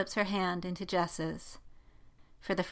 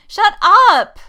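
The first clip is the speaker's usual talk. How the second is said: very high, very loud outburst; flustered shout; 14 semitones higher, 18 dB louder than usual. A narrator's reading. Jessica silences Poe about her crush, shouting. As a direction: shout down your teasing friend.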